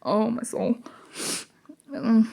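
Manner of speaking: ordinary delivery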